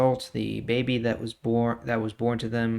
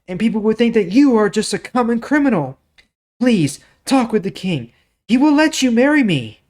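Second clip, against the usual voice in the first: high, loud begging, pitch climbing; desperate pleading; 10 semitones higher, 11 dB louder than usual. Acted. Tamar pleading with her brother, high and desperate. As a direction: beg him to stop, rising toward panic.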